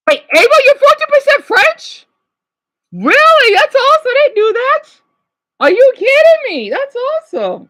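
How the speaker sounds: high, loud delighted squeal; thrilled by a viewer's news; excited. Real, not acted.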